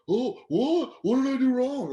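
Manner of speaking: high mock-innocent protest; clueless heckler. Heckler answers the angry comic, mock innocent. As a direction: protest high, as if you did nothing.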